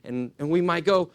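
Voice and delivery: ordinary delivery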